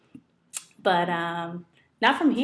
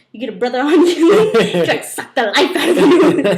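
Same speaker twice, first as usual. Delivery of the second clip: loud, laughing warning about men; half-laughing, loud advice; streetwise woman warning; 5 semitones higher, 11 dB louder than usual. Retold or imitated. Woman on the bus warning the narrator about men, loud and laughing. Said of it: "she's like".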